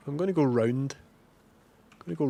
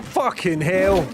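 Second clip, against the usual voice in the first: startled yell; 6 semitones higher, 8 dB louder, much slower than usual. Real, not acted. Attacked by panther in game.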